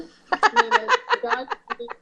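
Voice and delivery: high, giggling through the words; laughing. Real, not acted. Reader amused by a silly book title, laughing. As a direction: crack up laughing while saying it.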